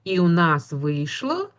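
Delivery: normal talking voice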